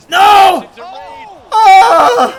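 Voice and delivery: high, loud scream of despair; anguished scream. Real, not acted. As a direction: scream out in agony. Opponent scores, streamer screams.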